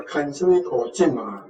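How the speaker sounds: normal talking voice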